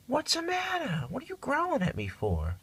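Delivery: high, soft coaxing voice to a cat; high cooing at the pet; pet talk. Real, not acted. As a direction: coax the cat gently, way up high. Cat growls at owner.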